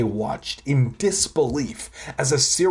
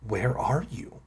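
hushed, softer question; whispering; 7 dB quieter than usual. A narrator's reading. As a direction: ask it under your breath. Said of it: "I whispered".

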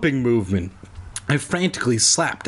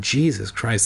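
ordinary delivery, nothing marked

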